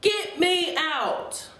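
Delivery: put-on high, slow, drawn-out child's cry; mock shout. A narrator's reading. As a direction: wail it out like a child demanding release. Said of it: "in the end I shouted".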